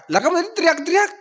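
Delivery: high, fast incredulous voice; incredulous villager. Retold or imitated. Villager accusing the shepherd boy, fast and incredulous. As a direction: rattle it off in disbelief.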